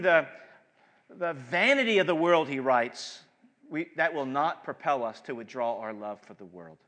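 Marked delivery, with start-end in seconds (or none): none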